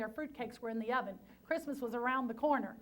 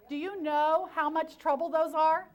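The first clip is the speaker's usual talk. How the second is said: louder and slower, half-joking exasperation; exasperated emphasis; 4 semitones higher, 8 dB louder than usual. Real, not acted. Speaker tells the audience about butterhorns, slow, loud, exasperated. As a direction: lean on how much trouble those are.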